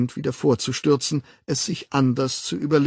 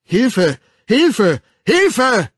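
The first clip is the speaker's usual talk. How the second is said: cries for help building from loud to very loud and higher; each cry bigger than the one before; louder each time; 11 semitones higher, 9 dB louder, much slower than usual. Acted. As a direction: Hilfe yelled ever higher and louder. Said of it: "jedes Mal lauter".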